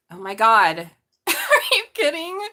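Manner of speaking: loud, high, laughing disbelief; stunned laugh. Real, not acted. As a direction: blurt it out in shock. Shocked by the tarot card.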